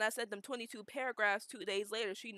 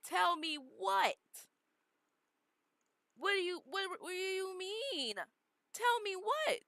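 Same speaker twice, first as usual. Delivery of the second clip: confused demand; 9 semitones higher than usual. Retold or imitated. Retelling her reaction to her brothers, high confused disbelief. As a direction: replay her shock at the secret. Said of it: "And I'm like".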